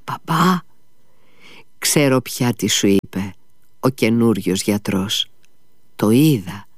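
plain reading voice

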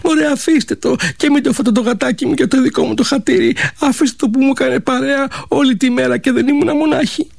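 high, begging plea to spare the kitten; girl pleading with her dragon parents, high and imploring. Acted. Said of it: "τους παρακαλούσε".

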